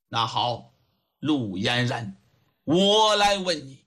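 low start, deliberate official questioning; judge interrogating. Acted. Judge begins questioning the young woman, measured and stern. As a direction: address the suspect weightily.

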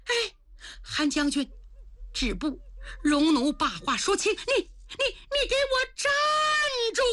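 high, rising to a shout on stop; young woman shouting at the general to stop; commanding shout. Acted. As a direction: halt him with a cry. Yanyan stops the general.

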